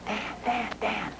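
frightened partner calling from the office, hushed and urgent; retold whisper. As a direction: imitate a panicked caller hushing into the phone. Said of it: "he's whispering".

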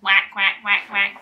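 loud playful quacks; duck quacking. Acted. Animal sound for toddler.

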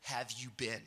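retold unhappy wife. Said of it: "she was not happy".

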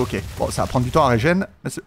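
ordinary talk

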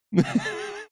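high squeaky burst of laughter. Real, not acted. Laughs between stickers.

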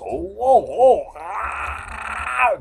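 high alarmed whoa, rising to a cry; startled cries. Acted. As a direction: yelp in sudden alarm. Things keep falling on him.

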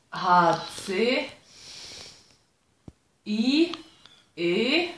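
slow letter-by-letter spelling; drawn-out letters with pauses; backwards spelling. Real, not acted. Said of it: "machst du mal meinen sagen". (langsam rückwärts buchstabieren)

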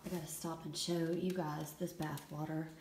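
normal talking voice

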